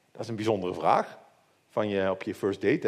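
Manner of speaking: ordinary talk, nothing marked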